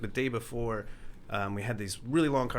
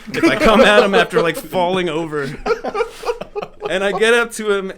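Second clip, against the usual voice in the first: loud, high, laughing through it; giggling at own clumsiness; 7 semitones higher, 11 dB louder than usual. Real, not acted.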